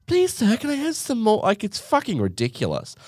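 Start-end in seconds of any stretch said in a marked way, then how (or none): 0.1-1.4 Oliver begging. Retold or imitated.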